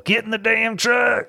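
high, hammed-up Southern yell; exaggerated yelled order in a put-on twang; mock drawled yell. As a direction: holler it like a caricature Texan. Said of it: "He doesn't yell".

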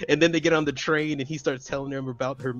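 normal talking voice

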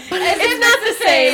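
laughs out a high, loud, slow protest; laughing, high and loud, stretching it out. Real, not acted. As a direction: giggle through the words, drawn out. Laughing at dinner offer.

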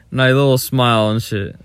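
usual speaking voice

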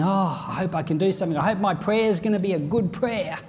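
mock nervous self-doubt; a believer fretting before he prays; anxious inner voice. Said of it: "So you're saying".

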